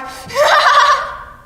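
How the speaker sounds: high, loud mocking cackle; harsh mocking cackle; mother's cruel laugh. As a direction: laugh hard and cruel at the child. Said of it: "she laughs hard".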